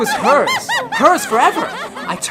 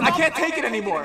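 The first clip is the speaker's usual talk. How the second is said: cracking up; 7 semitones higher, faster than usual. Acted. Man breaking under torment.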